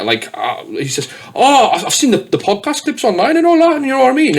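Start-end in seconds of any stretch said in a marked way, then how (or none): 1.3-4.4 high, lively retold gushing; mock eager fan. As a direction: gush like a fan who's spotted you. Retold fan explaining excitedly.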